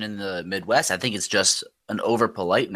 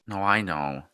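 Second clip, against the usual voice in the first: normal talking voice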